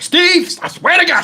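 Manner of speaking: high-pitched mock pleading woman on voicemail; mimicked frantic caller. Acts out Tony's frantic voicemail.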